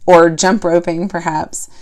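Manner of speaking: normal talking voice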